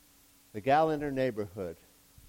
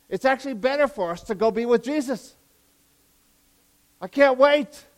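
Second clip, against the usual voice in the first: high, loud fired-up preacher; excited preaching; 9 semitones higher, 11 dB louder than usual. Real, not acted. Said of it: "Sorry, I get excited".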